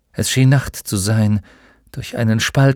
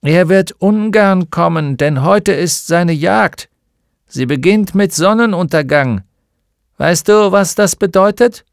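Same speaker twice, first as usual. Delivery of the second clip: put-on higher old villager cautioning the centaur; old man with crutches; 7 semitones higher, 6 dB louder than usual. A narrator's reading. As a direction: warn the stranger as an old man. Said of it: "ein alter Mann mit Krücken".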